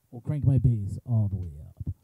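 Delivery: deep boomed bass voice; bassy voice. Shown as a demonstration. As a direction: drop into a bassy tone. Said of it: "make myself sound super bassy".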